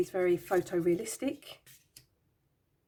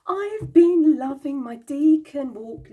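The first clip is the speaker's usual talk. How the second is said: excited; 7 semitones higher, 10 dB louder, much slower than usual. Real, not acted. Gasps, loves her Deacon Walk.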